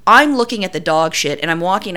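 normal talking voice

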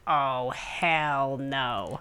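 slow, low, drawn-out dread; dismayed "hell no". Retold or imitated. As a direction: groan it out in disbelief. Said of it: "and I'm like".

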